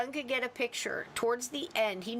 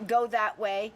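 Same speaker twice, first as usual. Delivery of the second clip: ordinary talk, nothing marked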